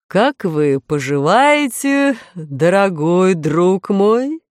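slow, gracious and affectionate; courteous. A narrator's reading. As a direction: greet him like a dear old friend. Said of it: "любезно начал король".

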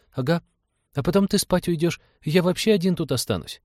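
put-on high-pitched child voice; complaining little boy. A narrator's reading. Boy to pilot about being left alone, high plaintive child voice.

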